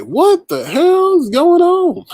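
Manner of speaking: very high, drawn-out, loud mock bewilderment; exaggerated amazement. Streamer reacting to so many people on Stereo, exaggerated amazement. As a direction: stretch it out high and loud in playful disbelief.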